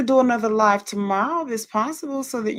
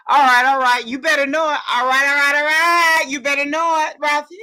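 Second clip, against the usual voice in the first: loud, higher-pitched cheering chant; raised, exuberant call-out; excited hype; 4 semitones higher, 7 dB louder than usual. Real, not acted. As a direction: hype up a friend, building on each "all right". Hyped cheering reply to chat comment.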